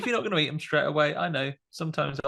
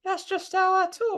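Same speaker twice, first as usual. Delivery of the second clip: much higher, slowed put-on kid voice; keeping the fake boy voice; slower than usual. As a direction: stubbornly stay in the child voice. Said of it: "You go".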